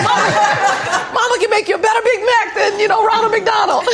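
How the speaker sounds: high, loud, laughing through it. Retold or imitated. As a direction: boast playfully while cracking up. Her laughing reply to son.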